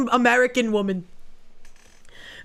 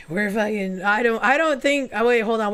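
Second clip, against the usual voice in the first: normal talking voice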